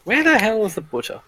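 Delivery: high-pitched real exasperation, falling away; exasperated, pitch jumping up then dropping; exasperated searching. Real, not acted. Game master hunting through notes for the butcher, exasperated.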